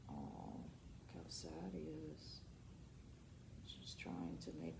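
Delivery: soft, low pitying murmur; sympathetic hush. Real, not acted. Reader points out the sad boy, soft pitying voice.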